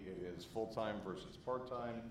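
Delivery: ordinary talk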